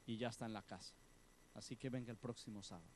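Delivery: woman hushes, husband is home; hushed. Acted. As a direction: keep it down so the husband won't hear. Woman fears her husband.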